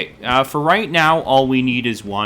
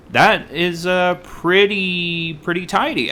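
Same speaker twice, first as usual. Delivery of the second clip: lightly put-on, higher-pitched drawn-out approval; slow, raised, a hint of comic impression; Larry David; 8 semitones higher, slower than usual. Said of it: "starting to sound like Larry David".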